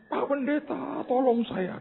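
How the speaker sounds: high, softened mock-tearful plea; imitated crying. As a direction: beg like a weeping caller. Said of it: "Dia nangis". (menirukan ibu menangis minta tolong)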